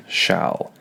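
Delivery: Shall. breathed unvoiced, loose and relaxed; unstressed, whispered Shall. Shown as a demonstration. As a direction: let Shall. fall out as a whisper. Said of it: "Is it relaxed?".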